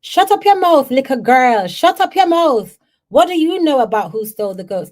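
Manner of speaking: high, loud shocked scolding; scolding grandmother. Retold or imitated. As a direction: snap at the little girl to hush. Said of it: "she said".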